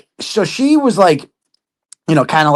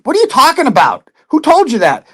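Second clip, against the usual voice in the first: indignant disbelief; 8 semitones higher than usual. Retold or imitated. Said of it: "I'm like".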